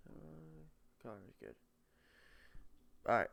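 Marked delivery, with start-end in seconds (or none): 0.1-1.5 whispering. Real, not acted. Aside while checking camera.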